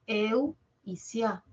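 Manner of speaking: eu y sia, each part said slowly and apart; slow syllable demo. Shown as a demonstration. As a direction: spell out the sounds eu and sia slowly. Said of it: "tiene dos diptongos". (separando despacio eu y sia)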